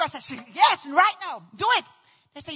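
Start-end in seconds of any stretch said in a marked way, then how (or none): 0.5-1.8 loud, emphatic insistence; urgent demand. Retold or imitated. Patient accepting offered epidural, loud urgent demand. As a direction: demand it without a moment's delay.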